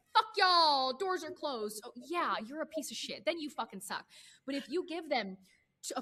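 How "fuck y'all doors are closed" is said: mock blunt announcement. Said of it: "Mama, if you walk in tomorrow".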